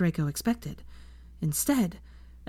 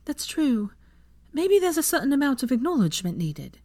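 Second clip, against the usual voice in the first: higher, bright encouraging woman; Hermione's voice; 8 semitones higher than usual. A narrator's reading. Narrator voicing Hermione encouraging Draco. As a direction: voice Hermione cheering him on.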